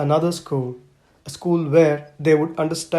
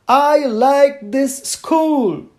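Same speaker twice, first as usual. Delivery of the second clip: higher, drawn-out slow cry of delight; whoop of joy; 8 semitones higher, 5 dB louder, much slower than usual. Acted. Little girl shouting her joy about the train school, slow and high. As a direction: burst out with pure happiness. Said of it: "let out a whoop of joy".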